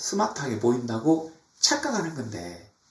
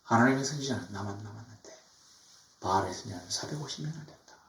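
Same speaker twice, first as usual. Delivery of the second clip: softly voiced, understated lament; a touch of sorrow, softer; Elijah's lament; 3 semitones lower, 7 dB quieter than usual. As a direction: lament quietly, a little subdued. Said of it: "엘리야가 탄식하기를".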